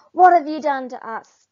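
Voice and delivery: loud, angry warthog demand. Acted. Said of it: "demanded the warthogs".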